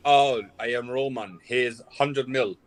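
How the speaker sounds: slow, mock Russian accent; Russian-accented, deliberate delivery; mad Russian accent. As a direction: play a Russian club owner, accent laid on.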